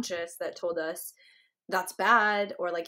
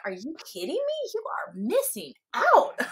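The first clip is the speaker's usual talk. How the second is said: incredulous friend; 8 semitones higher than usual. Retold or imitated. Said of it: "And I'm like".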